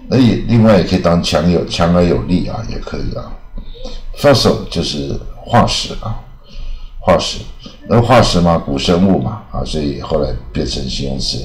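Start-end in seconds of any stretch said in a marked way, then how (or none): none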